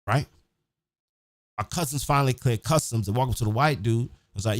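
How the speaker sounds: normal talking voice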